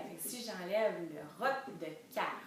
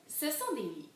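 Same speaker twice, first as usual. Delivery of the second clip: high, insistent objection; protestation; 6 semitones higher than usual. A narrator's reading. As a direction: protest it as a little princess. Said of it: "protesta-t-elle". (protestation aiguë de petite princesse)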